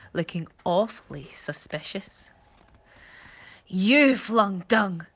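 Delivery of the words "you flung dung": slow, much louder, stressing you; knowing bellow. Acted. Monkey catches his brother Charlie, a slow knowing bellow. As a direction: nail the culprit. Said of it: "Farley bellowed knowingly".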